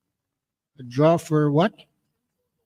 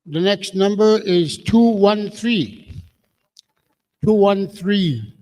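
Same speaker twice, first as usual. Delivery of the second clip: calling out a number; 2 semitones higher, slower than usual. Real, not acted. Calling ticket numbers at draw.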